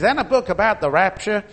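a hint of an American lady asking; quoting the American passenger in a mild American accent. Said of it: "in case you didn't get the accent".

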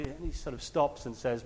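ordinary delivery

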